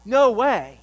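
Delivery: hammed-up indignant no; mock outraged shout. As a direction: bark it like an indignant master. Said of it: "God doesn't say".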